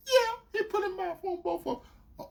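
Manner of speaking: high, small mimicked voice; sheepish girlfriend. Girlfriend confirming it to him, imitated meekly. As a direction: admit it softly in a high voice.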